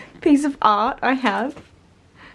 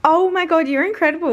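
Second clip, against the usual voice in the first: sarcastic praise; 6 semitones higher than usual. Acted. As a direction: gush sarcastically.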